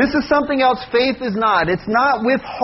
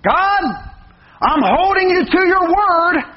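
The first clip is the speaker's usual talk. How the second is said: high, pointed mock prayer; 7 semitones higher than usual. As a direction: lightly parody a pushy pray-er, pitched up. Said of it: "People will pray like this".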